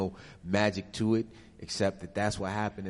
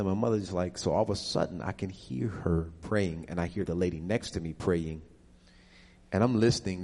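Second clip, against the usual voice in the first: ordinary talk, nothing marked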